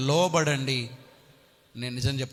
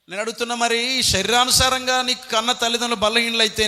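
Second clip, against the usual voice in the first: loud challenge; 7 semitones higher, 7 dB louder than usual. Real, not acted. Preacher challenges congregation loudly.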